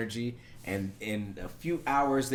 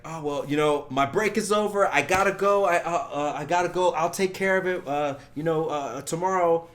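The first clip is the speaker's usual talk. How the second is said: higher, louder hurried excuses; mock flustered excuses; 6 semitones higher, 5 dB louder than usual. Brushing off the scammer, mock flustered. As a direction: stammer out a fake excuse.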